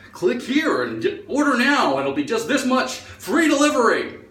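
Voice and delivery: high, gushing advert voice; website sales pitch. Retold or imitated. Website luring a shopper, high salesy pitch. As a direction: sell it with big sweeping pitch swoops. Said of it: "Same with websites".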